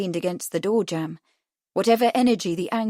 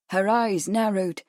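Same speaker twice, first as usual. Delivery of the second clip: ordinary delivery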